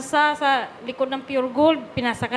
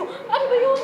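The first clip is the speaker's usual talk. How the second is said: a bemused, laughing pitch-up on Ano ba yun; amused disbelief, voice jumping up; laughing high question; 10 semitones higher than usual. Retold or imitated. Confused reply to God.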